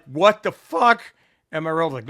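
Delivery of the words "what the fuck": shocked what the fuck. Retold or imitated. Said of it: "I'm like".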